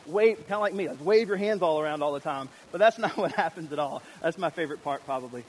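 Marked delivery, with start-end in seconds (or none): none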